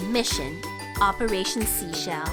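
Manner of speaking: normal talking voice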